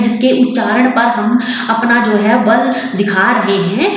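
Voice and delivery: usual speaking voice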